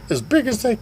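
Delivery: retold high-pitched question; high character voice. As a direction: pipe up in a put-on high voice. Voices Sugar Tits.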